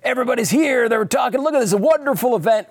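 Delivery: very excited game show host, pitched high; hammed-up host gushing in a high voice; Caesar Flickerman. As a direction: whip up the studio crowd. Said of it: "very excited".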